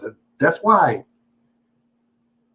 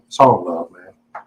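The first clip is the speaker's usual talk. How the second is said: ordinary delivery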